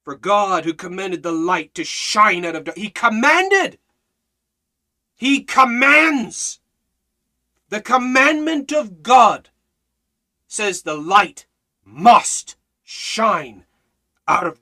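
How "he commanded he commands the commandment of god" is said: each word drawn out slow, pitch up; slow emphasis. Real, not acted. Preacher stressing God's command, slow and weighty.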